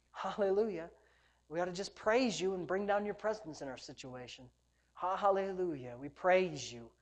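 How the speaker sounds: softened, low congregational prayer; unhurried soft praise, phrases set apart; quiet prayer. Real, not acted. Preacher prays with the church for His presence, quiet low prayer.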